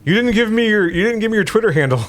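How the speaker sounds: high calls after someone leaving; calling out. Acted. Player calls after the departing character, high and pleading. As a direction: call her back, pleading.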